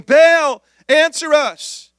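high, loud plea to an idol, then dragged out slowly; desperate call. Acted. Prophets begging Baal to answer, in a high, loud cry. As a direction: cry out to a silent god, drawing out the last words.

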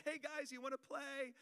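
high, bright mock invite; mock playful dad. Dad walking in on discipline, high playful call. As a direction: play the fun dad bursting in. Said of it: "and then I'll be like".

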